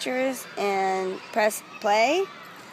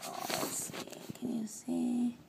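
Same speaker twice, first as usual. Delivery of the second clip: whispering; 7 dB louder than usual. Real, not acted. Whispers to camera throughout.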